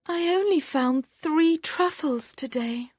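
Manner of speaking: put-on a tired girl counting her basket; sad thought. A narrator's reading. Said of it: "she thought sadly".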